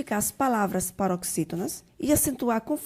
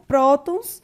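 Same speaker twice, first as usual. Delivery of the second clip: first syllable of "prótons" louder and higher; stress on PRÓ; 4 semitones higher, 8 dB louder than usual. Shown as a demonstration. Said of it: "sua sílaba tônica é o pró". (ênfase no PRÓ de "prótons")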